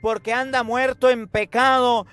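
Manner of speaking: high, ringing call to the parents; impassioned preaching. Acted. Preacher calling out to parents of lost children, impassioned preaching. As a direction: plead with the parents from the pulpit.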